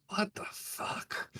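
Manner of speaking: hushed, slow, baffled whisper; the stunned guard whispering in shock. Acted. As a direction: breathe it out in disbelief. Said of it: "and goes".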